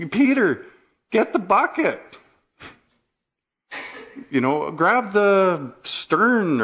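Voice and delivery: high, urgent cries of panicked sailors; a frantic call to bail water; frantic disciples. Acted. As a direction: shout orders as the boat sinks. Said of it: "And they're frantic".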